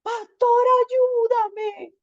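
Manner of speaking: high, loud, pleading cry for help; a frightened girl sobbing for the pastor. Retold or imitated. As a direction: beg the pastor through tears.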